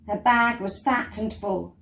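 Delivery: usual speaking voice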